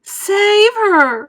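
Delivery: high, loud desperate cry; cried out. A narrator's reading. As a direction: beg for her daughter. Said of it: "cried Missus Beauregard".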